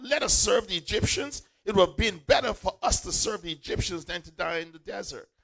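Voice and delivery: high, loud, panicked pleading; terrified crying out. Acted. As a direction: cry out in fear to Moses. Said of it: "They were terrified and cried".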